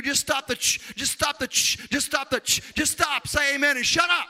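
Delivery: high, mock-exasperated demand; exasperated plea. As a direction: build to a loud, high comic outburst. Mock exasperated plea to noisy worshippers.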